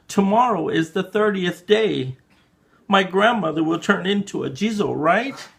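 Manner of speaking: high, worried rush of questions; frantic child. Acted. Child pressing the priest about the poison, high and worried. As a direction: plead in panic like a scared kid.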